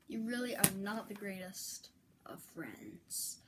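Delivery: breathy aside whisper; sulky whisper. Real, not acted. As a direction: hush it like a secret grudge. Unvoiced whisper to YouTube.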